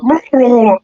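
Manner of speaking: high, rolling monster warble; creature's cry. Acted. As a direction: let out a creature's wobbling roar.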